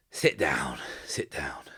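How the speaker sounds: put-on breathy drunk whisper to an old friend; raspy whisper. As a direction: hush it, sick and drunk, beckoning. Drunk sick old soldier invites Cole.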